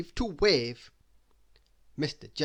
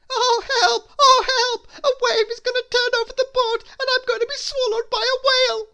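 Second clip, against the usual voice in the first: very high, loud frantic shriek; panicked shriek; 12 dB louder than usual. A narrator's reading. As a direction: scream for help in total panic. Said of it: "shrieked Mister Jelly".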